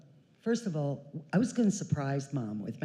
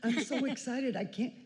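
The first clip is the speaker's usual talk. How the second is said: higher, gushing rush of excitement; gushing sister; 7 semitones higher than usual. Retold or imitated. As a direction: play the sister bursting with excitement. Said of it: "Franny runs to the door".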